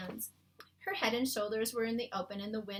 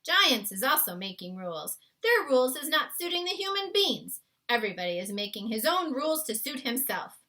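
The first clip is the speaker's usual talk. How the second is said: big friendly giant, louder and earnest; a kindly giant explaining his world to a girl; BFG voice; 4 semitones higher, 8 dB louder than usual. A narrator's reading. BFG's lines in story.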